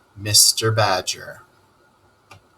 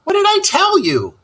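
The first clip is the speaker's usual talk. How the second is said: high, loud scolding told-you-so; 5 dB louder than usual. Acted. Rat scolds Toad after the failed attempt, high and loud. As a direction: rub it in from way up high. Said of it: "said the rat".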